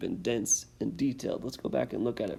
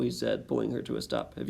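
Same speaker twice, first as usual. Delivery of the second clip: lively read-aloud, louder with rising tension; story narration; 2 semitones higher, 4 dB louder than usual. A narrator's reading. Teacher reading the exciting last page to students, dramatic storytelling. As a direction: build the chase for the listening kids.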